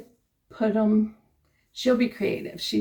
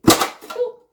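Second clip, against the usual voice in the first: high, loud sudden "oh"; surprised gasp; 9 dB louder than usual. Real, not acted. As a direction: caught off guard, blurt it out. Jar opens unexpectedly.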